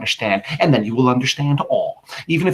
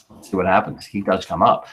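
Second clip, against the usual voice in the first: normal talking voice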